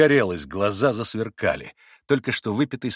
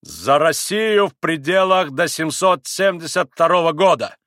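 loud, raised, measured proclamation; booming toast; 6 semitones higher, 7 dB louder than usual. A narrator's reading. Pan Vrublevsky makes a pointed toast, loud and booming. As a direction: declaim it slowly and resoundingly. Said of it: "зычным голосом проговорил".

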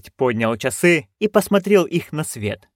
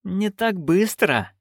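slow, higher-pitched menace; said through teeth; 7 semitones higher, slower than usual. A narrator's reading. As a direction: draw it out through clenched teeth. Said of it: "процедила герцогиня". (медленно цедя слова)

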